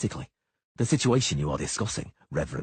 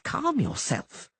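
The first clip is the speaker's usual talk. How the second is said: drawn out high and amused, calm yourself; slowed grinning repeat; much slower than usual. A narrator's reading. Halfling Dainty teasing the panicked poet, slow and amused. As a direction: stretch calm yourself slowly with a grin. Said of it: "Dainty grinned".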